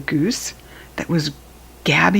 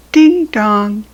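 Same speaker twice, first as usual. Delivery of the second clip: cowbell chime; 4 semitones higher, 6 dB louder than usual. A narrator's reading. Cowbell rings in story.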